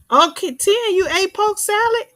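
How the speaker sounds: high excited disbelief at a viewer; excited surprise. Real, not acted. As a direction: sound thrilled someone actually ate it. Surprised a viewer ate poke salad.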